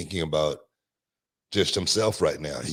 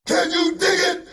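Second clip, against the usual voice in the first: catchphrase shout; 5 dB louder, slower than usual. Acted.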